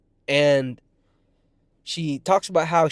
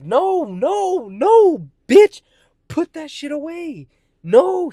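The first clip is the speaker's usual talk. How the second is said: very high, loud panicked protest; alarmed refusal; 13 semitones higher, 9 dB louder, much slower than usual. Retold or imitated. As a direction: cry out each refusal, pitch shooting up. Retold refusal of meth pipe.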